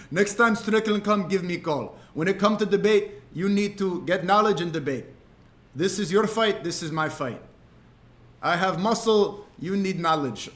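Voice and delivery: coaching in a put-on Dagestani accent; imitated Dagestani coach. Fighter advising streamer on Strickland, imitated Dagestani accent. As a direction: lecture like a stern Dagestani trainer. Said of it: "He was speaking to me the way".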